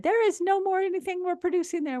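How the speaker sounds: high, put-on voice of a done-for organ; ovaries speaking. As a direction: announce brightly that the shop is closed.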